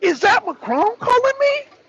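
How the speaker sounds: high, surprised mock Putin. Putin seeing Macron on caller ID, startled and high. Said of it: "He like".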